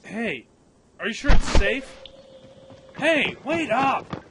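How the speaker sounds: loud, high shout, slow wait up; calling after. Acted. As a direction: yell after a friend who runs ahead. Calls after friend running off.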